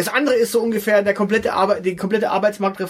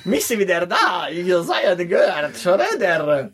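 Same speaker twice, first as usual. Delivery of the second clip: put-on Russian accent German. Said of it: "seinem russischen Akzent".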